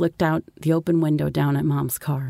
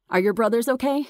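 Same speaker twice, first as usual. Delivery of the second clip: higher-pitched mother's voice; mom calling up; 6 semitones higher than usual. A narrator's reading. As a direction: call up a worried question, lightly acted.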